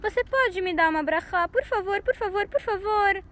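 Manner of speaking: high, tearful begging, voice breaking; crying plea. A narrator's reading. Esav crying and begging his father for a blessing. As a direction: plead through tears, again and again. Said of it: "Esav começou a chorar".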